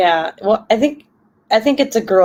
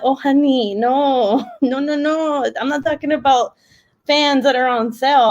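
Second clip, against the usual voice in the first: higher-pitched, pitying 'oh honey' correction; retold gentle put-down, laughter breaking in; amused correcting; 7 semitones higher than usual. Correcting her sister about fan sales, amused and condescending.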